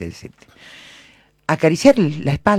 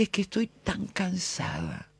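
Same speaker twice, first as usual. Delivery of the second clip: very soft, raised-pitch retold weariness; exhausted confession; 5 semitones higher, 12 dB quieter than usual. Tired mother confiding in the woman hugging her, exhausted confession. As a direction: let it out faintly, imitating a worn-out mother. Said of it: "era ese otro cansancio".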